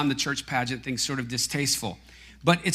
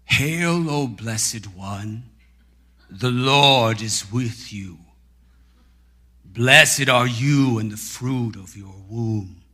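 slow, grand angelic proclamation with stretched words; Gabriel; 4 semitones lower, slower than usual. Retold or imitated. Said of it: "with echo on his voice".

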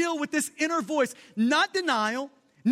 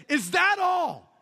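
slow, loud, defiant question; defiant shout; 5 semitones higher, 3 dB louder, slower than usual. Retold or imitated. Luther answering Satan with a slow defiant shout. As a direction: throw it back at the accuser. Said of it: "he looked back up at Satan and he said".

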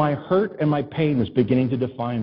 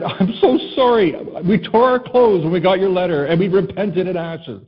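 mock dramatic apology; 6 semitones higher, 5 dB louder than usual. Imagined apology letter.

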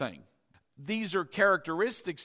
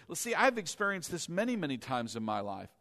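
normal talking voice

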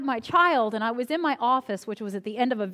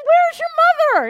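alarmed question; 10 dB louder than usual. Retold or imitated. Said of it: "I said".

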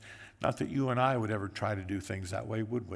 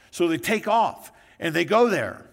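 excited; 6 semitones higher, 9 dB louder than usual. A narrator's reading. Excited retelling in sermon.

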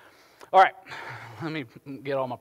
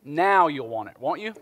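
ordinary delivery

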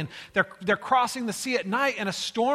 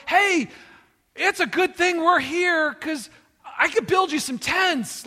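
loud, high, over-eager Peter; acted blurt, pitched way up; 10 semitones higher, 7 dB louder than usual. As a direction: pipe up loudly with a helpful idea. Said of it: "Peter couldn't stay quiet".

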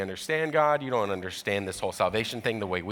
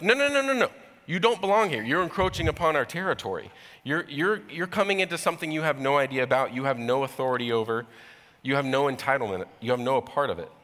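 mock indignant gatekeeper, fading to insistent; indignant refusal; 3 semitones higher than usual. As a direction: push the outsiders away.

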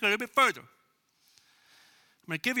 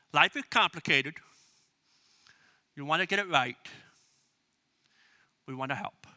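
measured pitch; 5 semitones lower than usual. Shown as a demonstration. Models elevator pitch to sceptic.

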